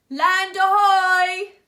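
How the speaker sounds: loud, slow, high lookout shout. Acted. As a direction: call it out to the horizon. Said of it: "shouted Sid".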